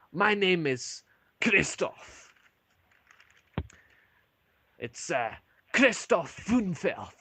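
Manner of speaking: slow, high, proud dwarf voice; dwarf introducing himself. Acted. Dwarf barkeep tells the adventurers his name, slow and high. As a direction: draw out the name slowly and proudly. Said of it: "He goes".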